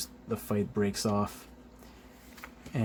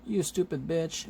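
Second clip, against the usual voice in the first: higher-pitched mock insult; Venom; 7 semitones higher than usual. Venom insulting Firestar, higher mock-angry voice. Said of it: "Venom is like".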